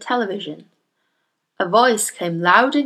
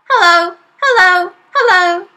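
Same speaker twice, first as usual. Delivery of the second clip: parrot voice; 10 semitones higher, 8 dB louder than usual. Acted. Parrot calling out.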